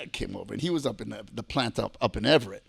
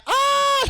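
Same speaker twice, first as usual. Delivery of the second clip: very high, loud mock scream; imitated scream; 9 dB louder than usual. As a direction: mimic screaming girls. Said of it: "They fucking screamed".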